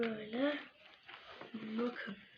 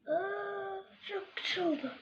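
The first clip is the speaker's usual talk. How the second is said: pleased surprise; 8 semitones higher than usual. Real, not acted. Delight at the balloon.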